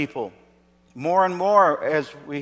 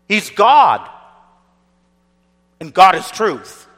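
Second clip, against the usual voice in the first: full-force preaching; 2 semitones higher, 5 dB louder than usual. Acted. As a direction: thunder it from the pulpit.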